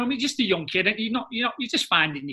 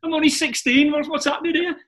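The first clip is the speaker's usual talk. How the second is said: shocked disbelief; 11 semitones higher, 6 dB louder than usual. Retold or imitated. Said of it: "And you're like".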